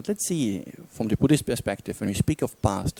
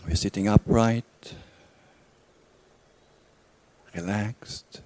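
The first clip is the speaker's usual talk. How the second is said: calm, unhurried meditation guidance with long pauses; guided meditation; 4 dB quieter than usual. Real, not acted. Teacher guides listeners back through a memory, calm meditation voice. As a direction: lead them inward gently, leaving silence between phrases.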